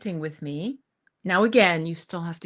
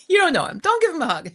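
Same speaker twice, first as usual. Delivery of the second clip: louder, higher, laughing through it; laughing inner voice; 7 semitones higher, 5 dB louder, faster than usual. Retold or imitated. Her inner voice warning her off a hug, laughing. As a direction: scold yourself while laughing. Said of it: "something in the back of my mind said".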